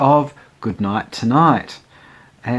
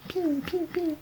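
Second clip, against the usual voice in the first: ping sound effect; 10 dB quieter than usual. Retold or imitated. Imitating buttons popping off.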